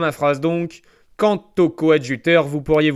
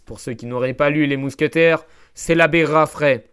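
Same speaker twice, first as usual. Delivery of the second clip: normal talking voice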